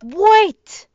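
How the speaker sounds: loud, high interruption; urgent shout. Real, not acted. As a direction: burst in loudly to halt everything.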